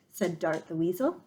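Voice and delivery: ordinary delivery, nothing marked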